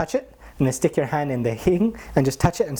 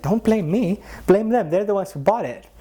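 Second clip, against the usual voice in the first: higher, cheeky shifting the blame; playful deflection; 8 semitones higher than usual. Retold or imitated. Guru blames devotees for sweets, high cheeky deflection. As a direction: point at the others, cheekily.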